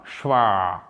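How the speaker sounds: schwa with a long, falling final vowel; drawn-out final sound. Shown as a demonstration. Teacher shows learners the word schwa, drawn-out final sound. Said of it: "laissez traîner, euh, le son final".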